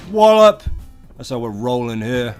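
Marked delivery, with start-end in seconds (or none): 0.1-0.6 high, loud battle cry; loud shout. Acted. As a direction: bellow it as you strike. Smashing an enemy in the game.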